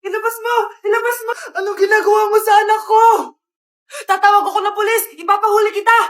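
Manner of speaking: high frantic yelling; panicked shout. Acted. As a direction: shout in alarm. Panic then threatening intruder.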